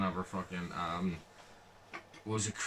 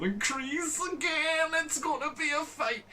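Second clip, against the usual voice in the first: hyped squeal. Retold or imitated. Fan reliving the Daniel and Kreese fight, thrilled and high. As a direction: squeal with excitement at the coming fight. Retold hype at fight scene.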